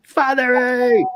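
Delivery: high, drawn-out greeting cry; gasp, then call the name out long and high; excited calling out. Real, not acted. Excited greeting as someone arrives.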